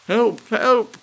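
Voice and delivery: screaming for rescue as he falls; cry for help. A narrator's reading. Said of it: "cried Mister Thurkell".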